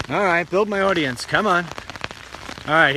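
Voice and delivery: ordinary talk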